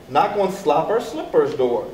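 ordinary delivery, nothing marked

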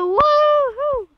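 high loud whoop of joy; cheer. Real, not acted. Player celebrating joining the server, high loud whoop. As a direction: let out a big happy whoop.

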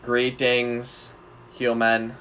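slow, low robotic alien voice; old speech synthesizer. Acted. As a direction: speak like a stiff alien robot. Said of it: "blind alien and human roleplay".

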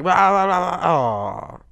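loud, high nonsense yell falling away; mock over-excited blabbering; hyper babbling. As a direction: imitate yourself being hyper. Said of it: "unless I'm hyper and I'm just like".

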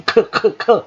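short repeated "เคอะ" k sounds; demonstrated k sound. Shown as a demonstration. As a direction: sound out the k as "เคอะเคอะเคอะ". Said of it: "เสียงเคอะเคอะเคอะ". (ออกเสียง "เคอะเคอะเคอะ" สั้นๆ ย้ำเสียงตัวเค)

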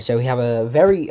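ordinary talk

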